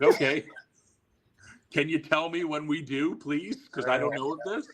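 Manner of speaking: mock-polite high sarcasm; sarcastic. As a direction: needle him, sweetly. Sarcastic reply to Sabu.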